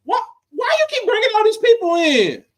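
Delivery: high-pitched exasperated outcry; hammed-up bewildered protest; mock indignant disbelief. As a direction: complain in a high, put-upon voice. Acting out shock at Carlito walking in.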